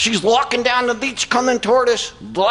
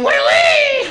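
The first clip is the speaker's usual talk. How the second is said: high, loud-ish mock "oui"; imitated French squeal; 4 dB louder than usual. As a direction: mimic him with a silly squeal. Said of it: "he started saying".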